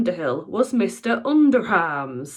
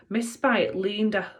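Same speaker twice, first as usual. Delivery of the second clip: plain reading voice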